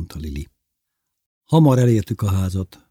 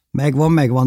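raised but milder echo of the cry; practical questioning reply; 6 semitones higher, 4 dB louder than usual. A narrator's reading. Bévi wondering how to get the fish down, questioning. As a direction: repeat it briskly, already onto the problem. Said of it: "kérdezte Bévi".

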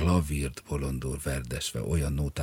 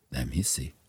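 ordinary delivery, nothing marked

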